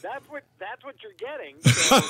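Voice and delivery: loud, right on the mic; phone pressed close. Real, not acted. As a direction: voice straight into the phone. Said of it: "sound like a voice box".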